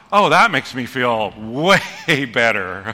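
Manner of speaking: slow, loud sarcasm with a laugh; sarcastic. Real, not acted. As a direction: drip with sarcasm.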